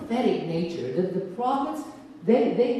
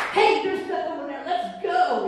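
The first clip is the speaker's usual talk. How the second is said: higher and bright, urging everyone to go; eager rallying cry; 7 semitones higher than usual. Acted. Prophet rushing in to lead the sheep away, eager and high. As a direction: pitch it like an excited call to adventure.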